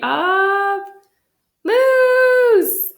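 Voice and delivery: high, loud, drawn-out title call; announcing title. Acted. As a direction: proclaim the title to the audience.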